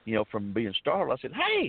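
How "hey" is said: sharp high call of hey; retold shout. Hunter confronting the man who aimed at him, high alarmed shout.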